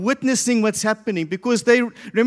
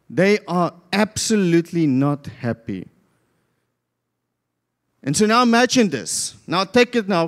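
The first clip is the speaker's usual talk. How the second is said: usual speaking voice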